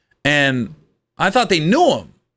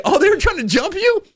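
high-pitched incredulous question; shocked realization. Retold or imitated. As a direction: replay the belated shock, pitch way up. Acts out belated shocked realization.

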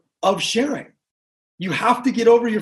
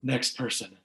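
deflated mutter; 9 semitones lower, 12 dB quieter than usual. Pretend. Waiter moving on, deflated.

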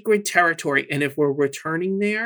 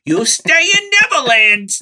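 high, loud, slow scolding toy mom; Mrs. Potato Head voice; 5 semitones higher, 5 dB louder than usual. Retold or imitated. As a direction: nag like a big cartoon toy mother. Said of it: "I made it Missus Potato Head's voice".

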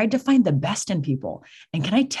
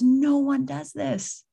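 higher, slow, heartfelt; touched and sincere; 7 semitones higher, slower than usual. Retold or imitated. Office lady telling the husband nobody praises drivers, slow and moved. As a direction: let the wonder sink in slowly.

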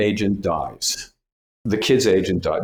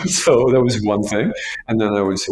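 normal talking voice

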